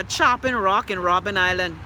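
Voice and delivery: usual speaking voice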